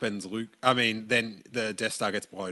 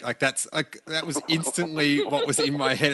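ordinary delivery

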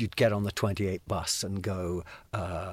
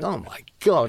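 awed exclamation; 7 semitones higher, 7 dB louder than usual. Retold or imitated. Retelling first sight of [name].

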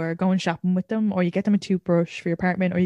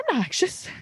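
defensive protest, pitched up; 6 semitones higher than usual. Retold or imitated. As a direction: snap back in mock denial. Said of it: "you get defensive".

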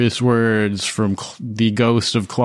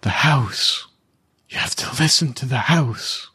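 pleading; 3 semitones higher than usual. Acted. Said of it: "with this pleading look".

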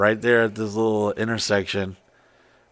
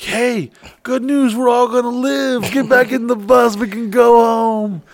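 excited call; 11 semitones higher, 4 dB louder than usual. Retold or imitated. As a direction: call everyone back in with relief. Calling the girls back to bus.

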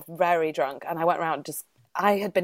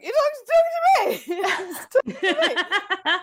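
very high squealed indignation; hammed-up high-pitched outburst, breaking into laughter; mock drunken protest; 14 semitones higher, 4 dB louder than usual. Acts out her drunken protest.

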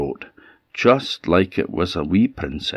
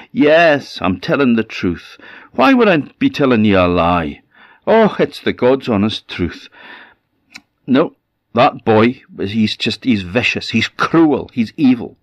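higher, emphatic protest of honesty; lying goat, indignant; 4 semitones higher, 4 dB louder than usual. Acted. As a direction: swear it, then hammer each accusation. Goat swears its lie is true.

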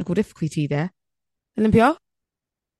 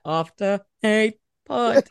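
slow, teasing imitation of a lagging co-host; mock delayed delivery; 3 semitones higher, slower than usual. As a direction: drag out "After Eight Pod" slowly. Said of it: "you're delayed".

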